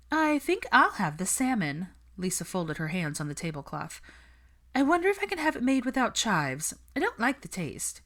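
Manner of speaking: fussy Lisa voice. Acted. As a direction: make the order sound like a complaint.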